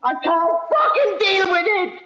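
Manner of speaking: desperate outburst. Real, not acted. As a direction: burst out at breaking point. Breaking point in upset rant.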